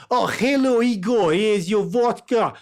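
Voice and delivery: high, hammed-up Eastern European accent; mock Eastern European accent. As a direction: greet in a thick fake Eastern European accent. Stereotype greeting among ordinary greetings.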